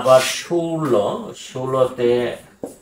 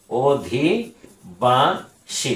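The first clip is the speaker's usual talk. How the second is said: অধিবাসী stretched out very slowly; dictating অধিবাসী slowly; very slow dictation; much slower than usual. Shown as a demonstration. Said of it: "teacher repeats word very slowly".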